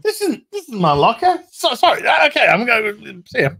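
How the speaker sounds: a cat apologizing, backing out fast; high cat voice. Retold or imitated.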